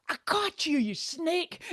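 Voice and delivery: high, teasing gotcha voice; playful accusation. Retold or imitated. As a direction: pounce on it like catching a sneaky thief. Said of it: "she'll be like".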